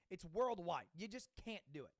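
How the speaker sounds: ordinary talk, nothing marked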